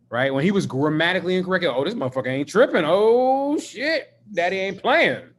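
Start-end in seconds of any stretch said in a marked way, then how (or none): 1.6-5.3 animated, hammed-up dread; mock alarm. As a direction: swoop up high on the realization, playing it for comedy. Voices his alarmed reaction to father.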